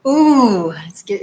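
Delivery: normal talking voice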